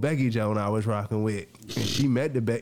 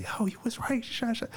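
high, urgent whisper; hushed retold confession; 14 semitones higher, 4 dB quieter than usual. Telling Mary she was right, hushed and high. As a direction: whisper it high, half under your breath. Confiding in Mary after the move.

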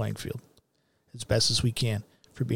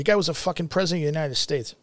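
ordinary delivery

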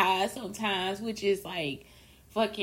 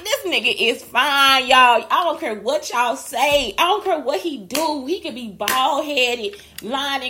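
gushing; 4 semitones higher, 13 dB louder, slower than usual. Real, not acted. Gushing about a crush.

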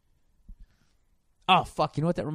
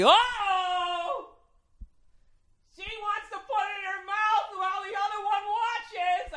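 high, far-carrying sportscaster exclamation; hammed-up announcer voice; loud announcer; slower than usual. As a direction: broadcast it across the bar. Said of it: "The fucking announcer".

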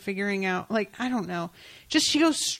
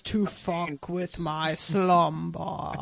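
grinding stone statue; 3 semitones lower, much slower than usual. Acted. Voicing Thessaly woken.

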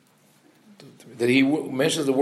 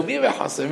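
"מי" pitched high, half laughing; mock bewilderment on "מי בחצר", much higher; confused king; 8 semitones higher, much faster than usual. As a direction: imitate a baffled king with a high, amused "מי בחצר". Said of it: "to show the, the confusion of the king".

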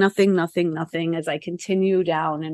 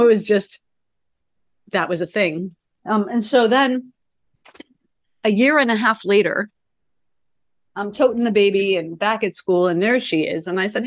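ordinary talk

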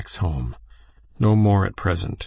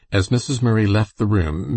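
ordinary delivery, nothing marked

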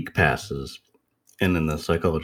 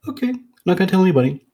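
rushed promise; 12 semitones higher, 5 dB louder, much faster than usual. Retold or imitated. Kid's quick promise.

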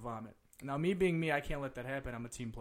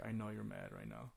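low, soft confiding voice; hushed aside to an upset friend, retold; murmured in the ear; 5 semitones lower, 10 dB quieter than usual. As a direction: lean in and say it privately, much softer. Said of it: "Like, in my ear".

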